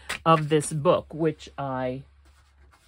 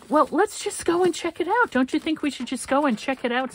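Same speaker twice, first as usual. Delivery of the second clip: much higher coaxing voice, imitating a friend; imitating Michael coaxing; 13 semitones higher, 4 dB louder than usual. Friend urging the annoyed mother to go, high coaxing voice. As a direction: coax her along in a high put-on voice. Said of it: "and so Michael said".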